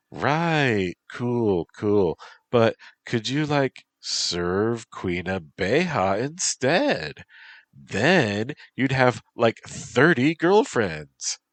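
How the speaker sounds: put-on high, teasing valley girl; bubbly valley girl teasing. Bee girl character speaks.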